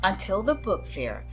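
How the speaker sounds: ordinary delivery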